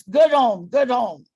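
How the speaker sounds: "good home" said firmly, stressing "good"; cue word demo. Shown as a demonstration. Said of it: "that's called home".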